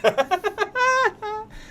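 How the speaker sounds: high, sudden laugh; burst of laughter. Real, not acted. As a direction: crack up high at the joke. Laughing at joystick joke.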